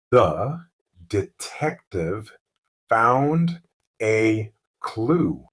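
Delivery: slow, word by word with pauses; slow practice reading. Shown as a demonstration. Teacher models the sentence for learners, slowly. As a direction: say each word separately for learners. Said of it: "Let's say it slowly first".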